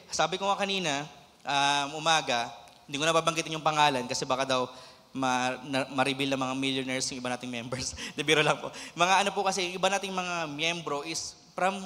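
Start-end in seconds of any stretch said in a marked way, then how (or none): none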